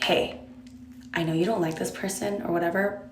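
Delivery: soft, hushed secret aside; whispered secret. Shown as a demonstration. Friend leaning close to tease bestie, hushed. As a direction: lean in and half-whisper it like a secret. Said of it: "like, whisper this".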